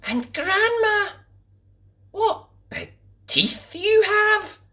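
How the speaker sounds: put-on high, slow amazed girl; little girl's wonder. A narrator's reading. Girl wondering at grandma's eyes, high and slow. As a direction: marvel wide-eyed like a child.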